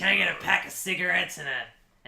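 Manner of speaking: hint of a chain smoker begging. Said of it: "E T sounds like a smoker".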